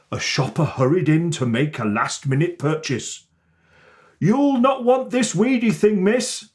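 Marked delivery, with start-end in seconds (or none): 4.2-6.5 high, dismissive shop assistant. A narrator's reading. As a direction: brush it off like a shopkeeper. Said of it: "said the shop assistant".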